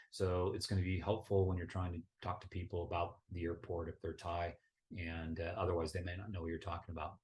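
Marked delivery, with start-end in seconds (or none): none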